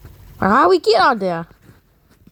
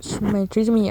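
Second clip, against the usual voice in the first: ordinary talk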